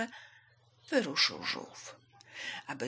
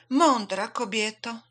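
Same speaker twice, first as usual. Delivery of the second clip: slow, loud, raised call; 4 semitones higher, 8 dB louder, slower than usual. A narrator's reading. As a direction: hail her urgently, stretching the words. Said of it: "zawołała".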